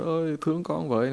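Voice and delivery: mock tearful plea. Demon posing as a child begs for pity, mock tearful. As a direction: lightly imitate a pitiful child begging, softly. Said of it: "con quỷ nó khóc lóc".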